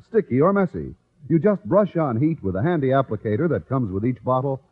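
ordinary talk, nothing marked

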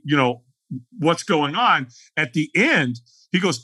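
ordinary talk

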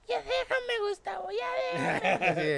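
very high, shrill-free mock shouting wife; quarreling woman. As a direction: yell at Gustavo to leave her alone, half laughing. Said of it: "la típica pareja peleándose". (mujer de la pareja peleándose grita a Gustavo, muy aguda y burlona)

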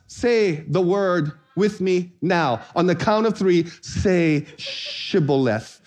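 slow, deliberate order, word by word; measured, spaced-out instruction; slow test command. Acted. Acting the test-giver at the crossing.

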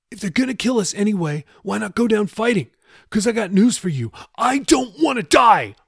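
high, heated plea; Jeffy, desperate. Acted. Jeffy urges Raoul to go down fighting, desperate and high.

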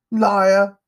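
put-on high, loud childish accusation; indignant shout; outraged boy. A narrator's reading. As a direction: hurl it back hotly. Boy accused of stealing retorts.

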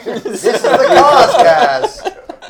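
very high, loud, slow show intro; announcer voice. Acted. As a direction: announce the podcast name with a laugh.

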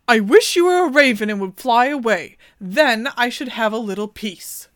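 put-on a queen wishing her baby gone; impatient queen's outburst. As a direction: snap it out like a tired mother.

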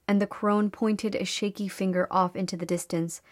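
usual speaking voice

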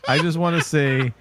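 usual speaking voice

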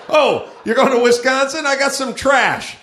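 high, loud, opportunistic pounce; hammed-up eager offer; mock eagerness. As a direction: seize the chance with pretend delight. Acted eager request to a traveler.

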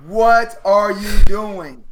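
slow, loud "What are you doing?"; loud slow disbelief. Retold or imitated. Imitating James Harden's face at Tucker, loud slow disbelief. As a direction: bellow it slowly, baffled. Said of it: "James Harden is like".

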